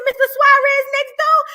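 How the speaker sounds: high, loud incredulous question; shocked question. Retold or imitated. Teacher asks student which teacher was beaten, shocked question. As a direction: pitch it way up in acted shock.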